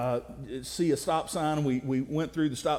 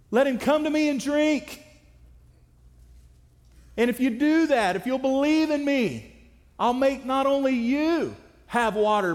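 proclaiming loudly; 6 semitones higher than usual. Retold or imitated. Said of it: "He's screaming at the top of his lungs".

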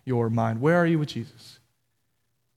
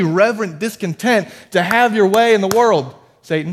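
fired up; 6 semitones higher, 5 dB louder than usual. Retold or imitated. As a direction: proclaim it like a fired-up pastor driving out Satan. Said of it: "he started getting fired up".

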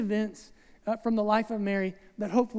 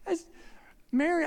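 ordinary talk

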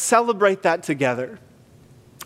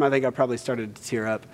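ordinary talk

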